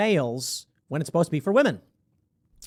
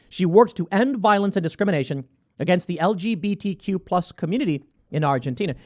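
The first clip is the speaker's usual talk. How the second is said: normal talking voice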